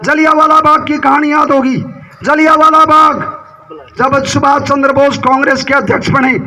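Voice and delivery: full-voiced political speech, phrase by phrase; forceful stump-speech delivery; rally oratory. Real, not acted. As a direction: address a big crowd in emphatic, sustained bursts.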